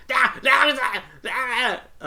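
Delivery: loud, high panicked cries; acted high screams of fright; scared yelling. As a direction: yell out in mock terror. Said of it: "he looks up and goes".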